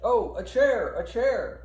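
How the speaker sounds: high, loud cry for a chair; desperate call for a stretcher. Acted. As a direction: shout for help urgently. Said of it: "He's calling for the stretcher again".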